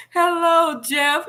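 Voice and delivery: high, bright hello; high cheerful greeting. Retold or imitated. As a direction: sing out hello. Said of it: "Jeffy Jeff".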